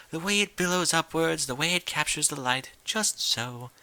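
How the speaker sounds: high, refined lady voice, lingering on the way; Rarity gushes over Rainbow's cloudscaping; gushing Rarity. Acted. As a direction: savor each word of praise.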